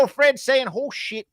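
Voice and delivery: high imitation of friends mimicking country talk; high-pitched teasing country-accent impression; mock country accent. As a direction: hoot it in a mocking, amused twang. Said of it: "They go".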